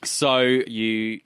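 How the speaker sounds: ordinary talk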